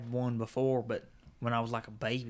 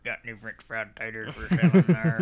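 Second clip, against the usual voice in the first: lower, louder Karl from Sling Blade; Billy Bob Sling Blade impression; 3 semitones lower, 9 dB louder than usual. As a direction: mumble it like Karl Childers. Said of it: "your Sling Blade impression".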